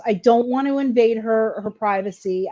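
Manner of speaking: normal talking voice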